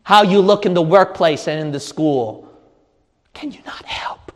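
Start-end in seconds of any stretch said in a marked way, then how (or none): 3.3-4.3 near-whispered, pleading question; hushed appeal. Real, not acted. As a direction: drop to a whisper to draw them in. Preacher drops to a hush.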